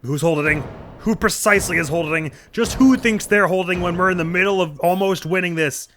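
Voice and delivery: huffy courtroom objection; indignant lawyer. Acted.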